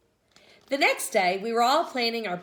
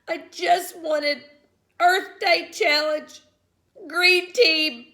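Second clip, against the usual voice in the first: sniffling; 8 semitones higher, 5 dB louder, slower than usual. A narrator's reading. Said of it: "I sniffed".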